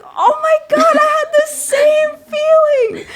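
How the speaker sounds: very high, loud, tearful outburst; excited shriek. Retold or imitated. At a friend's dinner table, shrieking excited recognition.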